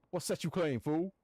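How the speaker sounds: loud street-thug challenge, pushed up high; hammed-up punk thug voice; tough gangster voice. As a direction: bark it like a gang heavy sizing someone up. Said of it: "saying it in this voice".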